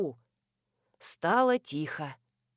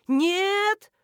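high, drawn-out cry of refusal; chorused cry; 10 semitones higher, 3 dB louder than usual. A narrator's reading. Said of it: "хором закричали мы".

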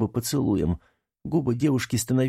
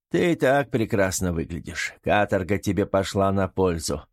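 ordinary delivery, nothing marked

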